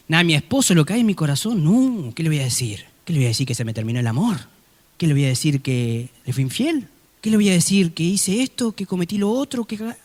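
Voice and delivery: ordinary talk, nothing marked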